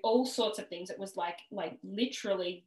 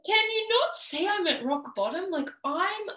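much higher and louder, retold frustration; wife at rock bottom venting at her husband, angry and high; pissed-off plea; 6 semitones higher, 5 dB louder than usual. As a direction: hurl it at him in exasperation. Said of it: "I was pissed 'cause I was like".

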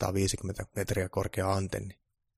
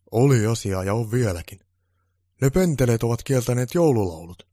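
angry; 4 semitones higher, 8 dB louder than usual. Acted. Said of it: "Joulupukki sanoi vihaisena".

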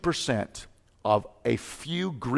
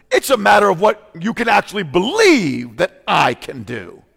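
loud, higher preacher's shout; full-force preaching on faith; 6 semitones higher, 8 dB louder than usual. Acted. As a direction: thunder it at the church.

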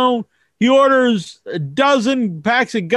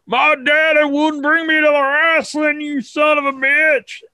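lightly voiced kid, a bit higher; jealous kid; 4 semitones higher than usual. Friend grumbling that his dad skipped wrestling, mildly kid-voiced. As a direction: sulk like a kid left at home.

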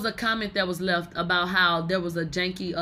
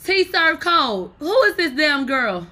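loud, high, slow disbelief; shocked outburst; 7 semitones higher, 7 dB louder, slower than usual. Real, not acted. Vlogger finding a stranger's page, loud shocked disbelief. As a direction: drag the words out in shock.

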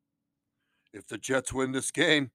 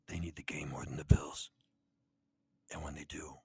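whisper; 10 semitones lower, 11 dB quieter than usual. Acted. Kid voice whispers pick.

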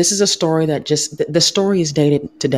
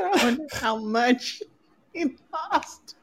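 mocking imitation; 8 semitones higher, 7 dB quieter, slower than usual. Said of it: "He said".

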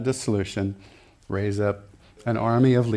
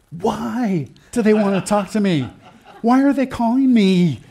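high, laughing, mock-panicked pastor; 8 semitones higher, 4 dB louder than usual. Preacher imitating a nervous pastor's call, high mock panic. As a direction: play the fretting pastor getting the phone call. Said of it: "Like this".

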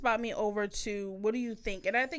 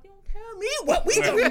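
raised, louder, laughing comeback; mock angry retort; 10 semitones higher, 6 dB louder, faster than usual. Retelling a fight with her partner, mock indignant. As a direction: snap back at him, half laughing.

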